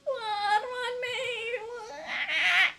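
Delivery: high fake sobbing bawl; hammed-up crying wail; mock wail. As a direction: bawl like a toddler. Imitating the creature's wail.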